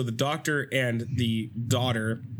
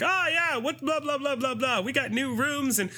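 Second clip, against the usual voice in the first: high, gushing innkeeper; cheery hotel keeper; 12 semitones higher than usual. Retold or imitated. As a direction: brag about the rooms like a chirpy host. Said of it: "the hotel keeper is like".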